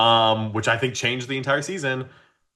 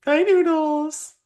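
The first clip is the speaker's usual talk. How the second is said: much higher, slow sing-song pet talk; talking to a cat; much slower than usual. Real, not acted. Host greeting the cohost's cat, cooing pet voice.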